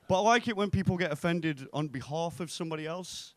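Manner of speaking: normal talking voice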